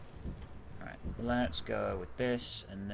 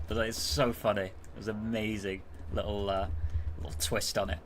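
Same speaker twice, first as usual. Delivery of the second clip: ordinary talk, nothing marked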